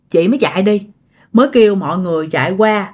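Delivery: plain reading voice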